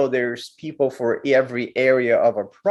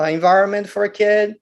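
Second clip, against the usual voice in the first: ordinary talk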